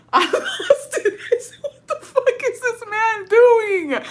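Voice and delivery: high, slow squeal through real laughter; losing it laughing. Real, not acted. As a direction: crack up helplessly while getting the words out. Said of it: "bitch, I lost it".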